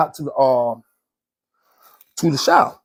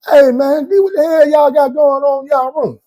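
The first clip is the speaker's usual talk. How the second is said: incredulous yell; 11 semitones higher, 5 dB louder than usual. Retold or imitated. Guys yell at him.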